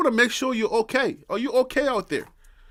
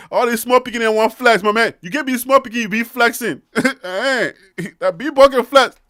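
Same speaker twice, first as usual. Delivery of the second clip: loud Liberian boasting, rising into laughter; gleeful mock-boast in Liberian pidgin; Liberian big-boy accent; 2 semitones higher, 7 dB louder than usual. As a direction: brag in a hammed-up Liberian accent. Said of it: "when you did the small boy, big boy accent".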